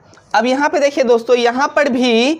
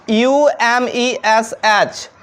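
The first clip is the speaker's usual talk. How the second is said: slow letter-by-letter spelling of Umesh; much slower than usual. Shown as a demonstration. Teacher spelling the name Umesh for students, slowly. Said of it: "example में है उमेश".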